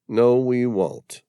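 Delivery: slow, firm denial; No, we won't, each word pressed hard and slow; emphatic. A narrator's reading. Patrick contradicting Yukitono, emphatic and slow. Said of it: "Patrick's words were emphatic".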